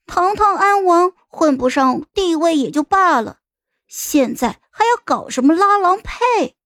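put-on high-pitched girl glaring in protest; indignant child. A narrator's reading. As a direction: scold a grown-up in a high child's voice. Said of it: "我冲他吹胡子瞪眼".

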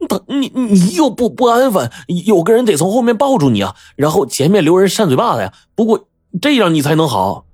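high-pitched flustered stammering; panicky, stumbling justification; nervous, hurried answer. A narrator's reading. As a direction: improvise an excuse in a sweat. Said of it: "冷汗都流下来了".

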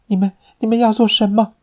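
high, fast, stammering fear; panicked and cornered; frightened. A narrator's reading. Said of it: "他惊恐地问".

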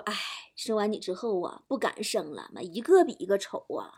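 low, soft, resigned; deflated mum, voice sinking; sighing mother. Acted. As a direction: sigh it out wearily to your child. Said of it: "老妈叹口气说".

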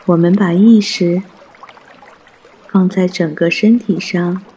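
ordinary talk

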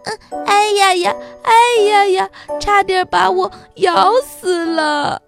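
high, loud yelps of pain; crying out. A narrator's reading. Giraffe pulls its head free from the wolf, yelping. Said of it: "一边叫着".

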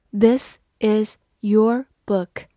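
slow, each word set apart; word-by-word reading. Shown as a demonstration. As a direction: read it slowly, pausing after every word. Word-by-word model reading, then normal take.

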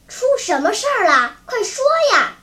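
high, loud urgent questioning; alarmed demand. A narrator's reading. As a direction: press anxiously for the bad news. Alarmed man demands news from boy.

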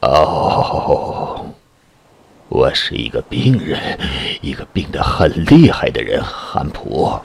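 low, slow moaning fear; sick and frightened. A narrator's reading. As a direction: groan it out, ill and scared. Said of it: "嗓子依然沙哑，声音充满惧怕".

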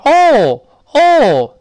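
mock pain cry as a high, loud "oh"; mistaken cry "oh". Teacher showing the cry nobody makes on a nail, mistaken "oh". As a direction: yell a long "oh", the wrong vowel for pain. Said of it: "你不能踩在钉子上，哦".

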